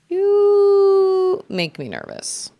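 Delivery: high, slow, stretched-out put-on voice; playful high-pitched imitation, drawn out; mock game callout. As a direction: hold the first word long and high, then drop. Mimicking a game voice command.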